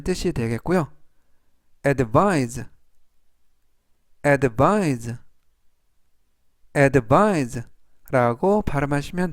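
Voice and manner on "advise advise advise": careful, spaced-out vocabulary drill; slow model reading. Shown as a demonstration. Teacher reviewing words for learners to repeat, slow careful reading. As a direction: read it three times, leaving room to repeat. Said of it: "제가 천천히 세 번씩 읽어드리고요".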